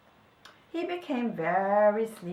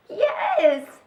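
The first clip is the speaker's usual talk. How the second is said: high, loud yes; tiny creature piping up eagerly; little badger voice; 11 semitones higher, 9 dB louder than usual. A narrator's reading. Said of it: "a little voice".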